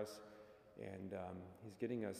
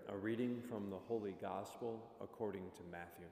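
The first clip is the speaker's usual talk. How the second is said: formal liturgical reading, projected; gospel proclamation; 2 semitones higher, 4 dB louder than usual. Acted. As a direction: proclaim it like a priest at mass.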